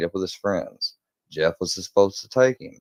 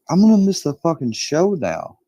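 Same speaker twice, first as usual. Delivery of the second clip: loud, high-pitched retold complaint; imitated angry whine at full volume; angry outburst; 11 semitones higher, 7 dB louder than usual. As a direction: act out an exasperated blow-up, pitch leaping. Retold quarrel, angry about concert.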